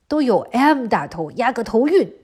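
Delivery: plain reading voice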